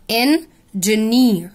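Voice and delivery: engineer stretched very slowly, en-gi-neer; syllable by syllable. Shown as a demonstration. Teacher breaking a word into syllables for students, very slow. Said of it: "فبص كلمة farm اتقسمت far ومر".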